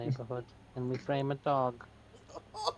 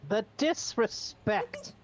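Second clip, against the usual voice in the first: high, slow scandalised gasp; hammed-up offended cat; mock outrage; 7 semitones higher, much slower than usual. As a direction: clutch pearls at the rudeness.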